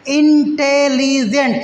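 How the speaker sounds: इंटेलिजेंट slow, each beat apart; split into syllables. Shown as a demonstration. Teacher counts the beats of इंटेलिजेंट for students, slow. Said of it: "चार बार में बोल रहे हैं".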